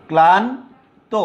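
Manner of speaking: slow demo of ক্লান্ত; pronunciation demo. Shown as a demonstration. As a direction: stretch ক্লান্ত slowly for the class. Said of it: "যেহেতু ক এর দুইবার উচ্চারণ".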